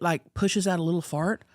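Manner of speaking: usual speaking voice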